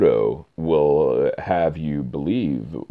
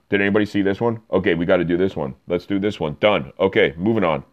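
higher, louder, rushing through a to-do list; hurried checklist voice; 5 semitones higher, 4 dB louder, faster than usual. Retold or imitated. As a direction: tick items off briskly, louder. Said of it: "did J J Abrams, and just go".

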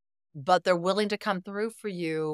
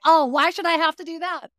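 mock whiny protest; 11 semitones higher, 8 dB louder than usual. Mock partner's resentful reaction.

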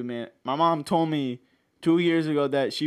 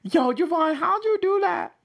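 much higher playful squeak; high squeaky voice. Pretend. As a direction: mimic the squeak back, very high. Reacts to high voice.

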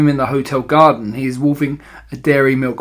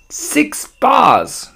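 high, excited exclamation; high-pitched, gleeful small-boy voice; greedy boy's cry; 11 semitones higher, 3 dB louder than usual. A narrator's reading. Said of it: "cried the greedy little swine".

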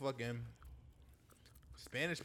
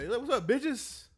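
mock greeting; 8 semitones higher, 6 dB louder, much slower than usual.

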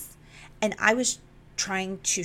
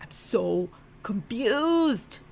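drawn-out, high plea of bafflement; exasperated confusion; 5 semitones higher, 3 dB louder, much slower than usual. Retold or imitated. Telling him over and over she can't follow, exasperated confusion. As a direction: stretch it out slowly, helplessly confused.

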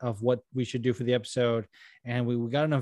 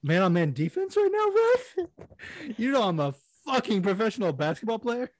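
mock indignant player; 7 semitones higher than usual. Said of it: "he's like".

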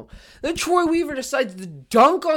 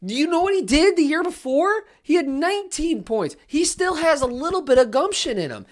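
can't believe what he's hearing; incredulous rant; 6 semitones higher than usual. Real, not acted. Host arguing with imagined Knicks fans, incredulous.

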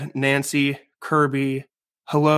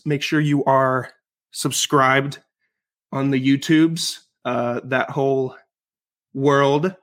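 normal talking voice

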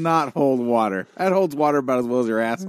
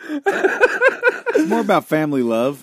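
mock sincere; 5 semitones higher, 5 dB louder, slower than usual. Mock sincere defense of piano.

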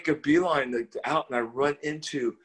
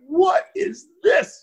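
high, drawn-out incredulous shout; shocked disbelief; 6 dB louder, much slower than usual. Retold or imitated. Hippie reacting to a church orchestra, loud shocked disbelief. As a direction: cry it out slowly in appalled amazement.

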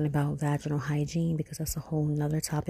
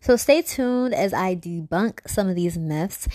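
intro voiceover; 3 semitones higher, 5 dB louder than usual. Acted. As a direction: announce the episode teaser. Recorded intro teaser.

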